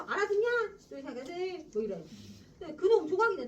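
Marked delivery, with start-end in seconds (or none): 0.0-0.5 put-on loud, high old man's challenge; demanding grandfather. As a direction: bark the question at him.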